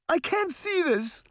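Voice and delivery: high, distressed outcry; tearful cry. A narrator's reading. Sabrina cannot bear seeing Granny, high tearful cry. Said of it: "Sabrina cried, tears in her eyes".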